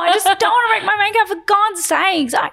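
high, loud stressed-out rant; overwrought, high-pitched complaint; frantic exasperation. Retold or imitated. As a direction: blurt it out as a hammed-up meltdown. Acted outburst over wearing a mask.

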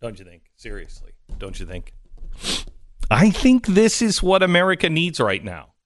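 ordinary talk, nothing marked

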